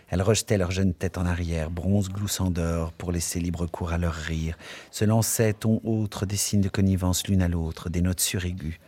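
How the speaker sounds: plain reading voice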